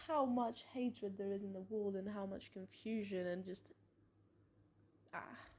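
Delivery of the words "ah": normal talking voice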